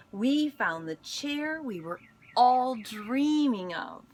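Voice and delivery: plain reading voice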